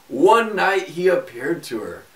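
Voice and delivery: ordinary delivery, nothing marked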